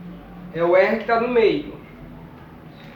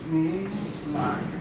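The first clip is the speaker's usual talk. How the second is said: soft repeat; 4 semitones lower, 9 dB quieter than usual. Shown as a demonstration. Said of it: "no meio".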